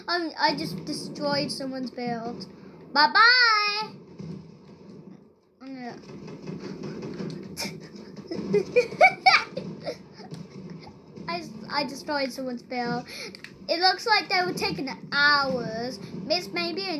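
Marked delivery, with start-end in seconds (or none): 6.0-10.9 breathy giggle. Real, not acted. Destroyed someone's build.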